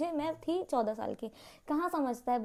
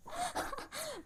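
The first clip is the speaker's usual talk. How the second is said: loud laugh; 10 dB quieter than usual. Real, not acted. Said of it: "मैं इतनी तेज हंस रही थी".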